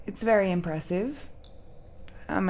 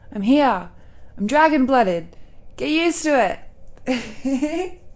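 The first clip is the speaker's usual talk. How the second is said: loud, higher-pitched playful boast; proud declaration; 5 semitones higher, 6 dB louder than usual. Acted. Dragon-blooded speaker declaring who they are, loud proud boast. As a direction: proclaim it boldly, punching each phrase.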